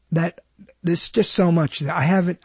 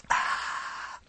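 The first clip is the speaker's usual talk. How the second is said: a breathy "ah" after a sip; refreshed drinking gasp; 13 dB quieter than usual. Shown as a demonstration. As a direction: sigh out after the water. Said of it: "Always make noise like my father did".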